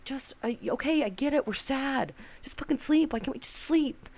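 high, exasperated rant at herself; frustrated outburst. Retold or imitated. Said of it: "I was so mad at myself".

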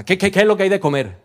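mock pushy husband at the door; demanding husband. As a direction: play the rude husband walking in. Husband demanding food at home.

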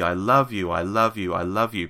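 "love" leaned on each time, the rest falling away; stress on love. Retold or imitated. John suggesting the middle section, stress on "love". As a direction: hit the word "love" in every "I love you". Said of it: "emphasis should fall on the word love each time".

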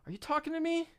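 very high-pitched incredulous question; retold high-voiced challenge; acted challenge. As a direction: pitch it way up, calling him out. Acting out challenge to cellmate.

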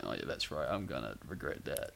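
low quiet dread; dread muttering. Retold or imitated. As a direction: mutter it under your breath. Retold inner dread.

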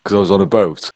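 normal talking voice

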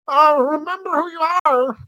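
very high, loud wail; hammed-up dramatic appeal; mock pleading. Host mocking the photo-throwing scene, hammed-up high plea. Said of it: "he's like".

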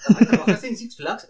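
high, loud impressed interviewer; HR lady voice. Retold or imitated. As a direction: play the impressed HR woman. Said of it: "a la morra de recursos humanos". (la de recursos humanos, aguda y fuerte)

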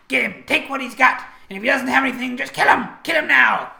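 high, loud shrieked battle orders; yelling bandit cries. Acted. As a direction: yell it like a bird-bandit calling for blood. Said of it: "you can hear them yelling".

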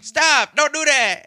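mock yell at gorillas.

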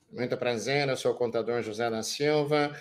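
low, dull self-introduction drone; mock a tedious accountant reciting his CV; lightly voiced dull presenter listing credentials; boring speaker. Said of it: "que cara chato da porra".

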